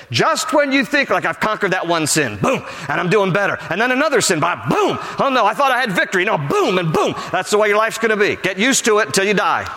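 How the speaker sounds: high-pitched, driving sermon delivery; forceful preaching. Real, not acted. Preacher warning friends about constant battle, forceful preaching. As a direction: pound the point home to the congregation.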